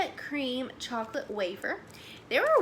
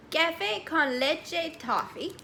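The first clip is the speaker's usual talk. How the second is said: slow attempt at a Spanish accent; horrible Spanish accent; 4 semitones higher, slower than usual. Real, not acted. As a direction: read the name slowly in an amateur Spanish accent. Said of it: "ignore my horrible accent right there".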